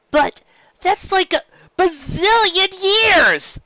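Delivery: a filly outraged at too much homework; loud whining protest. Acted. Scootaloo protests the homework to her teacher, whining loudly. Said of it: "Scootaloo objected".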